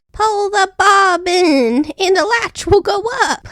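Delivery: put-on high, slow old woman calling; the grandmother calling from bed, high and slow. A narrator's reading. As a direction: sing out instructions like a frail granny. Grandmother cried out.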